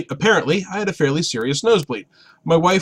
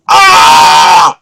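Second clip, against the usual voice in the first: loud waking panic yell; groggy husband bellowing in alarm; startled yell; 13 dB louder than usual. Retold or imitated. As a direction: yell like you just woke to chaos. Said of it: "So I start yelling".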